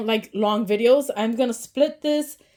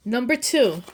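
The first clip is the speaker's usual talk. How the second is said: ordinary delivery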